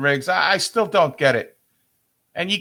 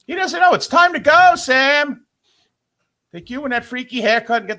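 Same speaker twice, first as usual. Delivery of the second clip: shouting at Sam; 7 semitones higher than usual. Acted. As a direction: yell it like you're calling him off the air. Telling the announcer to retire.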